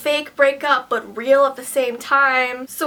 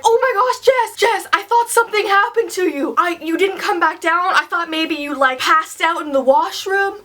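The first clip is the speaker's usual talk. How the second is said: put-on high frantic worried boyfriend voice; frantic high-pitched worry; panicked boyfriend; 5 semitones higher than usual.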